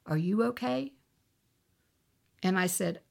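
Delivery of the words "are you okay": soft question, rising at the end; gentle voice of God. Retold or imitated. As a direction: ask softly, as the Lord's quiet prompting. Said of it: "I heard the Lord say".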